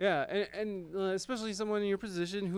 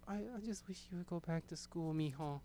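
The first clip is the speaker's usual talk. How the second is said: mom voice; 10 dB quieter than usual. Retold or imitated. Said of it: "Add in your own Mexican accent there".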